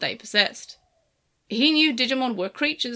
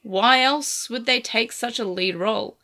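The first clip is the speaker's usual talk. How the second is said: plain reading voice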